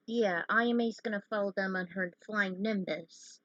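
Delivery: plain reading voice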